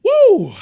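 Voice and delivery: loud, high release whoop. Real, not acted. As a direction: blow off steam with a big whoop. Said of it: "that's a lot that came out of my mouth".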